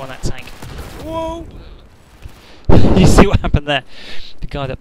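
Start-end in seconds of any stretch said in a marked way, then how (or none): 1.0-1.5 very high, drawn-out cry of surprise; startled whoop. Real, not acted. Gamer watching the tank blow up, startled whoop. Sudden tank explosion in the game. 2.7-3.8 loud burst of laughter into the question; laughing. Real, not acted. Gamer amazed by what blew up the tank, real laughing.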